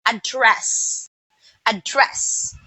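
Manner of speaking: "Address" repeated alone, second syllable stressed. Shown as a demonstration. Teacher repeating the verb for students, second-syllable stress on "Address". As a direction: isolate "Address" as a-DDRESS, twice. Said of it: "the second syllable was stressed".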